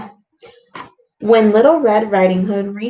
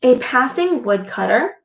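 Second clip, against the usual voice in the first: ordinary delivery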